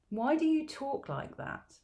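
put-on high, slow little girl asking; curious young girl, pitched high and slow; child's voice. Elsa asks.

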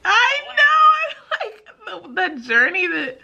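high, tearful laugh; emotional laugh with a friend; laughing through tears. Real, not acted. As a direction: laugh while fighting tears.

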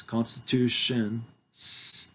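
constitutions, very slow, stressing the S at the end; drawn-out plural. Shown as a demonstration. Reading to witnesses, underlining the plural. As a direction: drag out every syllable of constitutions. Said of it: "there's an S in there in parentheses".

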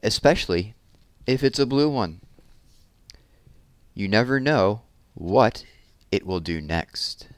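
lively picture-book read-aloud, big expressive swings; reading to children. A narrator's reading. Teacher reading a balloon story to children, animated storytelling. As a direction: read it like story time for little kids.

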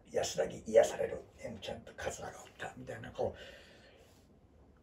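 soft, slow near-whisper; hushed whisper. Real, not acted. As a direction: murmur it as if sharing a secret. Hushed caption over old photos.

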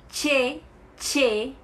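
che with a TCH sound; letter name. Shown as a demonstration. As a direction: say che as tche, clear. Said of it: "se chama".

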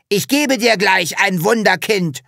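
bully Hubert angry at being mocked. A narrator's reading. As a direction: snap back in rage. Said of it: "sagte Hubert wütend".

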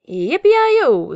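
high, loud cowboy whoop; excited cheer. A narrator's reading. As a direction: whoop it out with joy.

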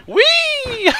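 very high, loud gleeful squeal; high sing-out whee; playful whee. Pretend. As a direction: squeal as if bouncing along. Cheers trampoline jumping.

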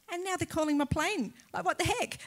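high-pitched mock disbelief; comic exasperation. Plane called at the worst moment.

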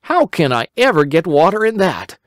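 put-on high, eager boy voice; a worried little boy pleading; Simple Simon. A narrator's reading. Simple Simon explaining to the rabbit, high boyish voice.

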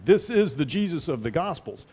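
ordinary delivery

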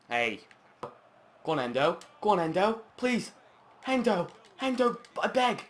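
high, loud begging; high desperate plea at the screen; desperate pleading. Real, not acted. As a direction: urge him on, begging. Begging a player to score.

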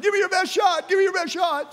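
photographer yelling. Retold or imitated. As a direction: call it out to the runners. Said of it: "he's yelling at you".

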